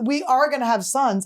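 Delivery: ordinary delivery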